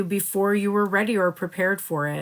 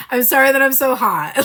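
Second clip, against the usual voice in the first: louder, higher mock apology with a laugh; sorry not sorry; 7 semitones higher, 9 dB louder than usual. Voicing the Feminine boasting to the Masculine, sorry not sorry. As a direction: laugh through a cheeky non-apology. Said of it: "she's usually like, "I'm sorry, but I'm not sorry".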